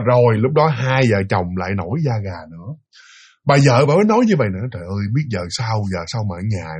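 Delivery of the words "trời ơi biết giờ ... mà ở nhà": low, soft despairing mumble; weary lament. Retold or imitated. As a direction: imitate a wife at her wits' end. Wife despairs over the haunted house.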